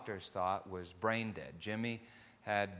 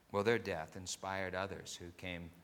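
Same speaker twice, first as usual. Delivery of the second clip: normal talking voice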